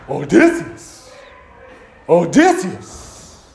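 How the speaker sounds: slow, loud, drawn-out growl of the name; vengeful Cyclops. Retold or imitated. Wounded Cyclops calling after Odysseus, slow and loud. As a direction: draw out the name like a monster swearing revenge. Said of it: "and he's like".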